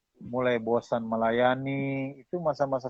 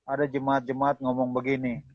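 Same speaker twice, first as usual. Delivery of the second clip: ordinary talk, nothing marked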